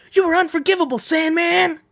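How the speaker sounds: high, loud furious cry. Acted. A warrior screams his condemnation at Sandman, high and loud. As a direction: hurl it at him with full fury. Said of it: "I should do that a little more".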